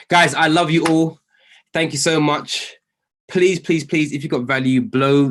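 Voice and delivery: loud, high burst of gratitude; excited thanks. Real, not acted. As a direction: thank them with a happy shout. Thanking the audience warmly.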